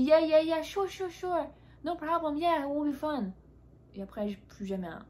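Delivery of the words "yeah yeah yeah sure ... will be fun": mock breezy neighbor. Neighbor fobbing off the dog-walk invite, mock chipper. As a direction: brush off the invitation in a hammed-up cheery voice. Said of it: "Elle me dit".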